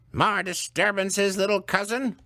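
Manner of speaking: stern elder woman; stern old woman. Acted. Missus Gudrun's voice.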